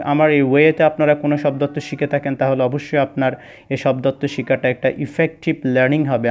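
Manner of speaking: ordinary delivery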